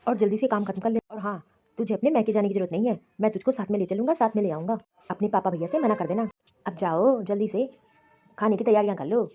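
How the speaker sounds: a domineering husband laying down the law; bossy husband. Acted. Husband tells wife to finish work and skip her parents.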